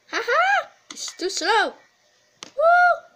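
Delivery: high gleeful whooping; triumphant whoop. Real, not acted. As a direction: cheer at escaping. Escaped Granny, triumphant.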